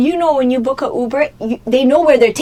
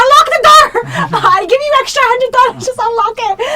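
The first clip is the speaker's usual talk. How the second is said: much higher, much louder tearful screaming; 13 semitones higher, 6 dB louder than usual. Retold or imitated. Yelling at the Uber driver to unlock the door, high, loud and tearful. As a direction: shriek at the driver through tears. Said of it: "and screaming".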